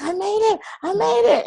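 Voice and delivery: high, squealing mock-excited "I made it"; excited sister. Sister coming home after making the team, high excited squeal. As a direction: play the thrilled girl, way up in pitch. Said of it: "so excited".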